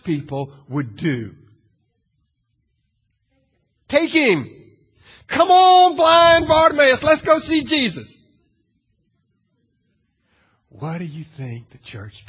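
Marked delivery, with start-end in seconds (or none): 3.9-8.2 much higher and louder, cheerful urging; eager invitation. Pretend. Church crowd urging the blind man toward Jesus, loud and eager. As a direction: sweep him along with eager enthusiasm. Imagined crowd helping Bartimaeus.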